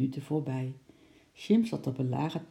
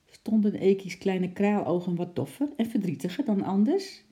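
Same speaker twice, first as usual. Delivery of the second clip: plain reading voice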